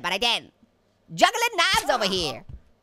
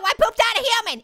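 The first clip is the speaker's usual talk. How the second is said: laughing; 8 semitones higher, 3 dB louder than usual. Real, not acted. Laughing at game event.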